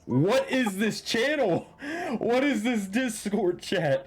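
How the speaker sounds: high, slow, incredulous disbelief; baffled. Real, not acted. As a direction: stretch out each word in disbelief. Baffled by broken session audio.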